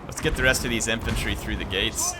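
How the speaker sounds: ordinary talk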